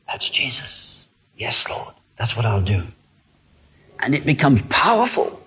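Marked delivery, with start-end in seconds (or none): 0.1-2.8 whispered inner reply. Retold or imitated. Voicing inner response.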